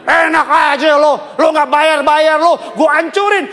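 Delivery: hammed-up thug shouting for payment; thug demanding money. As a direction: play a street enforcer banging on the door. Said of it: "dia gedor ruko kita, bilang".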